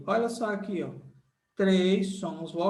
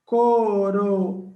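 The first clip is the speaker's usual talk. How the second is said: syllable by syllable; 7 dB louder, much slower than usual. Shown as a demonstration. Teacher showing students the syllables, slow and emphatic. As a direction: spell out each syllable slowly for the class. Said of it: "Letra C é a nossa certa".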